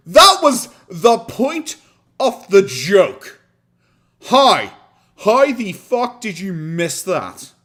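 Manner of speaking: loud, high, slow shouting; exasperated, drawn-out yell; incredulous yelling. Real, not acted. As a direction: shout each word out, stretched in disbelief. Exasperated at a missed joke.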